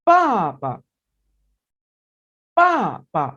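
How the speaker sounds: पापा loud, last पा short and light; neutral tone demo. Shown as a demonstration. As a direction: say पापा with a light clipped second पा. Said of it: "उसको short करके और light वे में अदा करना है". (पापा, दूसरा पा छोटा और हल्का)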